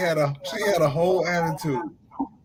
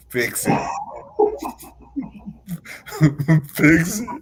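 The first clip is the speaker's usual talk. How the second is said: loud talking through laughter; laughing; 2 semitones higher, 6 dB louder than usual. Real, not acted. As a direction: talk while laughing.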